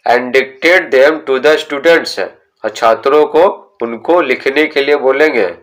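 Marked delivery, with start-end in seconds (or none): 0.1-2.2 very slow, word by word instruction read; slow textbook reading. Shown as a demonstration. Teacher reading the book's instruction to students, very slow. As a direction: spell out the instruction slowly for pupils.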